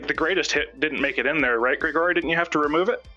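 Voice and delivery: usual speaking voice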